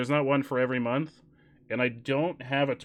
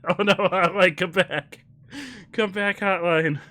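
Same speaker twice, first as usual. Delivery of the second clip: laughing call; 6 semitones higher, 5 dB louder than usual. Real, not acted. Calling after the leaving hotline.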